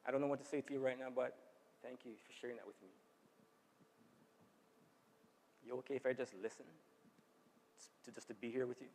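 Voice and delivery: softly consoling someone who is crying; gentle empathy. Shown as a demonstration. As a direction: show real care, hushed and kind. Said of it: "How about we try something like".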